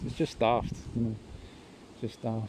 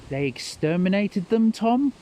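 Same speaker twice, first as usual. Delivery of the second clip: higher, louder imitation of nan; nan's blunt answer; 8 semitones higher, 5 dB louder than usual. Nan tells Tom over the film, higher mock voice. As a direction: lean in and state it, rising on Tom. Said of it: "she leans in and she goes".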